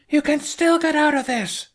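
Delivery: high, loud holler. Acted. Astrid pleading with the fleet, high loud holler. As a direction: call it out across the water at full voice. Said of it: "Astrid hollered".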